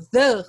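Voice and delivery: THE with a closed schwa, "thuh". Shown as a demonstration. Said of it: "que é mais fechada".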